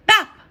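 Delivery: sharp, loud, high bap of a ball striking; bap impact sound. A narrator's reading. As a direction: punch out the impact noise. Ball hits the wall.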